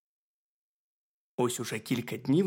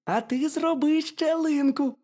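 high, squeaky little hedgehog; very high, loud cartoon critter voice; 6 dB louder, slower than usual. A narrator's reading. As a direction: pipe it up as a tiny curious hedgehog. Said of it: "сказав їжачок".

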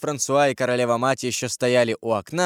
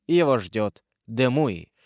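ordinary delivery, nothing marked

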